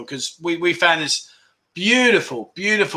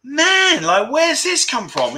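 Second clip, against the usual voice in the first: amazed; 9 semitones higher, much slower than usual. Retold or imitated. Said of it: "I'm like".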